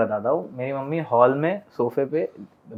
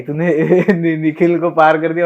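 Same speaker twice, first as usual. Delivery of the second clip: laughing; 6 dB louder than usual. Real, not acted. Quoting mom, laughing mid-quote.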